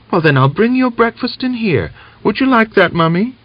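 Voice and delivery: a small daughter asking her sick mother; little girl. A narrator's reading. Little girl offers breakfast.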